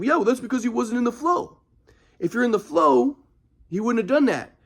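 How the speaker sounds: lightly voiced mellow guru; imitating grandpa. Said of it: "my grandpa would be like".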